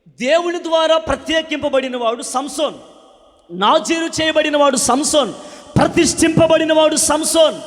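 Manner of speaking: loud, high, ringing preacher's cry; fervent revival preaching, loud and lifted; full-force preaching. Acted. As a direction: preach it out to the whole hall. Preaching climax.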